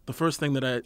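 normal talking voice

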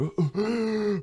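sharp high gasp for air; surfacing gasp. Pretend.

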